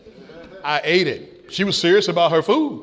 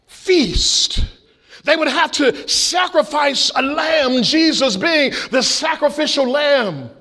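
raised, ringing preacher's cry, much higher and slow at the start; preaching at full force; 9 semitones higher, slower than usual. Acted. Pastor proclaiming to the congregation, preaching at full force. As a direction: proclaim the sacrificial lamb to the whole church.

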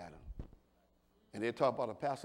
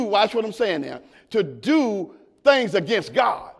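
loud, higher, stretched preaching shout; full-force preaching; 11 semitones higher, 19 dB louder than usual. Acted. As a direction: preach it to the back row, slowing on key words.

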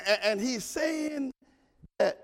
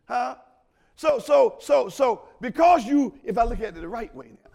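ordinary talk, nothing marked

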